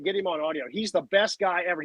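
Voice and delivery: usual speaking voice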